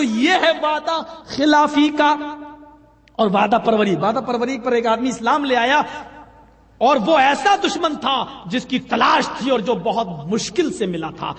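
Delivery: normal talking voice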